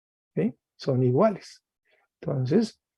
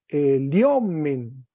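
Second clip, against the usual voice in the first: "eliomen" drawn out, weight on the O; stress on second-last syllable; much slower than usual. Shown as a demonstration. Teacher shows class the moved accent, stress on O in "eliomen". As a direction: lean on the O in "eliomen". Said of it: "de la tercera sílaba a la segunda".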